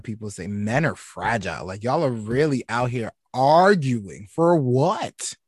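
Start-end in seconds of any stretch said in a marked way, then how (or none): 1.8-5.4 exasperated rant. Real, not acted. Ranting at arguing men.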